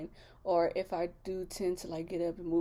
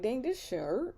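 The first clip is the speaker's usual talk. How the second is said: retold pained complaint; 5 semitones higher than usual. Acts out reaction to cramp pain.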